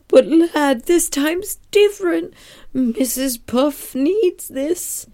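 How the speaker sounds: high, slow, pleading crab voice; Mister Krabs. Retold or imitated. Crab boss talks SpongeBob round, high pleading voice. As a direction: coax the lad, stretching the words high. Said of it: "Mister Krabs is like".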